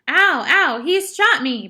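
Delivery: high, loud yelp of pain; pained scream. A narrator's reading. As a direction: cry out loudly, hurt and outraged. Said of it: "Patrick screamed".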